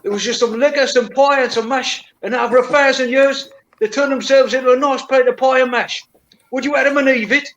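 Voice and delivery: high, hammed-up cockney voice; cockney accent. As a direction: tell it like a London geezer. Cockney story, rhyming slang.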